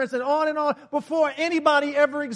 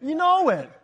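loud call; 6 semitones higher, 6 dB louder than usual. Real, not acted. Preacher answering congregation loudly.

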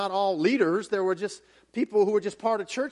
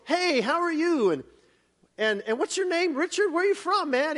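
higher, chatty voice of a welcoming president; a lightly raised, friendly imitation of the president; 6 semitones higher than usual. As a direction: play the warm leader greeting a stranger. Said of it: "He goes".

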